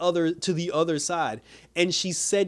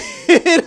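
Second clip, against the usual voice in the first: loud laugh; 13 semitones higher, 11 dB louder than usual. Real, not acted. Fan laughing at the plot hole, loud outburst. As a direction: burst of loud disbelieving laughter.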